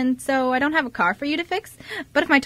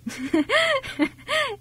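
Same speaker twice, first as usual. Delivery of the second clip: high laugh; 11 semitones higher than usual. Acted. Reacting to the age question.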